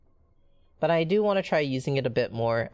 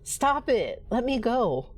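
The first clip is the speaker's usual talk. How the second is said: put-on frightened girl pleading; 9 semitones higher, much slower than usual. Rachel pleads with Danny.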